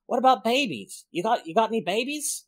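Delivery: playful mock Uni impression; imitating Uni. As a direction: quote Uni in her odd accent, half-butchered. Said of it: "I'm gonna butcher this accent".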